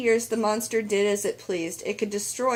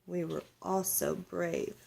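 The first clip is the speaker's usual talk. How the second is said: much softer, a near-whispered pride; hushed grandmother; 3 semitones lower, 8 dB quieter than usual. Acted. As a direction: say it quietly to the grandchild. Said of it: "her abuela whispered".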